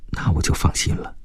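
soft breath-only whisper. A narrator's reading. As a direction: whisper it privately to your seatmate. Friend's whispered reply in hall. (悄声耳语)

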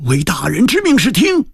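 high, emphatic pledge of obedience; men answering obediently. A narrator's reading. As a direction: respond as eager henchmen, pitched high. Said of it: "一齐躬身对孙延龄道".

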